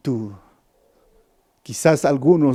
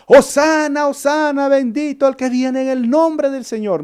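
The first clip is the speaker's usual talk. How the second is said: higher jubilant crowd shout, fading a little; crowd shouting hosanna; 9 semitones higher than usual. Retold or imitated. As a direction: call it out like the cheering crowd.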